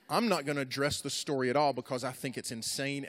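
normal talking voice

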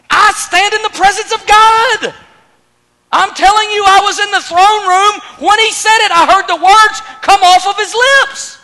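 high, shouted angelic declaration; fervent, loud confrontation; emphatic retort. Acted. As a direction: shout it as unanswerable authority. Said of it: "spoke back to him in the same emphatic language".

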